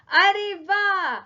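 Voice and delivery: high, loud, bright exclamation of delight; delighted wow. Acted. Teacher to the children, introducing two friends: high, loud delighted wow. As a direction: greet the new friends with a big happy wow.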